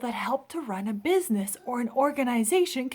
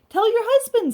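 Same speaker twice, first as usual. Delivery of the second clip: bright, high, louder eager pitch; eager suggestion; 13 semitones higher, 8 dB louder than usual. Acted. Amelia eagerly telling Mrs. Hardy to ask her husband. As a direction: jump in helpfully with the idea.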